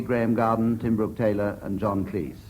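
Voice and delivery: ordinary delivery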